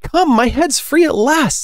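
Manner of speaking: high, drawn-out cry of delight; high-pitched storybook delight. A narrator's reading. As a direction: exclaim slowly in a high girlish character voice. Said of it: "in a tone of delight".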